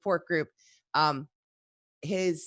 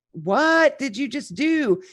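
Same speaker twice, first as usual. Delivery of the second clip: slow, higher and louder, stern mock scold; mock scolding parent; 7 semitones higher, 5 dB louder, slower than usual. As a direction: drag out each word like a stunned parent. Parent scolding a naughty kid.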